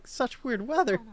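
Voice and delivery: usual speaking voice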